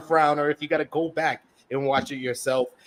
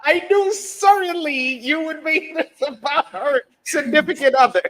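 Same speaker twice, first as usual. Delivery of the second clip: mock accusation; 10 semitones higher, 5 dB louder than usual. Mock accusing co-host, raised voice.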